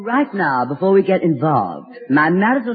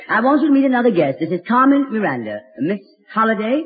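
ordinary talk

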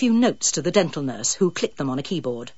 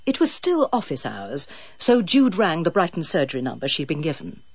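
usual speaking voice

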